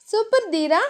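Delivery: put-on flustered young wife; shy heroine. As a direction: brush him off shyly, voice lifted. Dhiya voiced higher.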